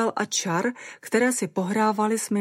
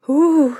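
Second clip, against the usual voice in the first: loud, high moan of pleasure; 9 semitones higher, 10 dB louder than usual. A narrator's reading. Caroline overwhelmed by Simon licking her fingers, moaning. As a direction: let out a wailing "ooh". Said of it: "zaúpěla jsem".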